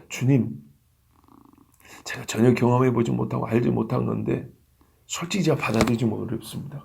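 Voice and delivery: low, subdued voice of a disciple; humble reluctance. Acted. (낮고 조심스럽게 털어놓는 제자 목소리)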